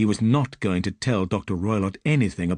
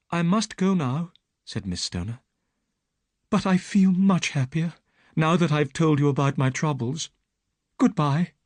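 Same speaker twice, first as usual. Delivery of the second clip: put-on young woman's voice, higher; frightened young woman telling her story; Miss Stoner; 8 semitones higher, 3 dB louder than usual. Young woman pleads with Holmes, higher voice.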